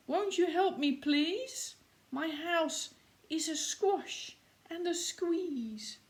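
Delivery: put-on high, pleading old lady voice; a high fretful old woman asking for help. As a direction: beg the wise man in a high little old lady voice. Old lady pleads to wise man.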